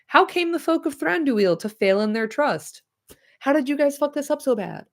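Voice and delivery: high mock outrage. Reader's joking high modern aside.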